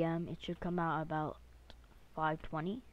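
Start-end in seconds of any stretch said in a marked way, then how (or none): none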